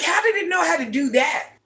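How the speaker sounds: high-pitched mock astonishment; playful, high, incredulous question; amazed. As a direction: squeal it in wide-eyed wonder. Retells her own amazed reaction.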